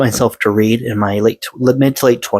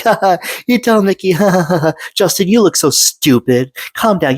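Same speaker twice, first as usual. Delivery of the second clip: higher, sneering imitation of a sister's jeers; mocking taunt; 8 semitones higher than usual. Sister mocking her little brother for being upset, jeering. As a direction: mimic the sister teasing him, mocking.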